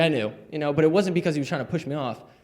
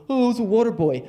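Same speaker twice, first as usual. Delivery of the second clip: imitating a moaner, pitched higher; higher, whiny quote; mock complaining; 6 semitones higher, faster than usual. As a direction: bemoan it with mock self-pity. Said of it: "most people complain".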